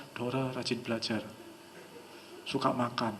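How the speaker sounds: low, soft, monotone list; hushed suggestion demo; heavy flat intonation. Shown as a demonstration. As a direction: recite it quietly without emotion. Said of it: "intonasinya agak berat".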